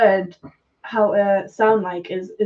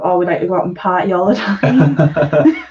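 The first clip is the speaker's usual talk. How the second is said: lightly voiced party Geordie; a hint of Geordie Shore party voice; 3 semitones lower, 4 dB louder than usual. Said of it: "that reputation of".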